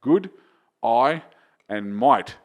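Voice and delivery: "Good", "I", "might" as three separate words, pauses between; separated words. Shown as a demonstration. Coach teaching three words one by one, "Good... I... might" spaced out. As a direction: say "Good", then "I", then "might", each distinct. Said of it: "three words in your own accent".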